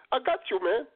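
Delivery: ordinary talk, nothing marked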